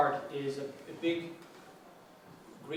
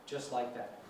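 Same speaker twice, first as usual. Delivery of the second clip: ordinary talk, nothing marked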